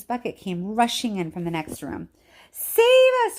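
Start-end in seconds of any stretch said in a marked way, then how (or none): 2.5-3.4 loud frightened plea; terrified cry. A narrator's reading. Grandma Josephine crying out as the roof caves in. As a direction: beg to be saved. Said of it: "cried Grandma Josephine".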